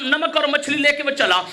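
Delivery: ordinary talk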